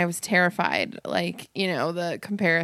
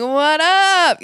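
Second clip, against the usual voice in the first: cool greeting; 8 semitones higher, 8 dB louder than usual. Retold or imitated. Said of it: "I was just like".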